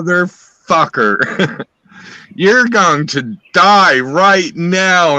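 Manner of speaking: gun-drawn menace spat at the intruders; threatening. Retold or imitated. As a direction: drag it out like you mean to kill. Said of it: "And I said".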